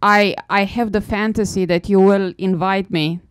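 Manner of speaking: ordinary talk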